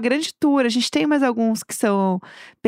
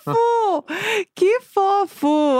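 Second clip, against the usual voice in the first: gushing; 7 semitones higher, much slower than usual. Real, not acted. Cooing at the cute story.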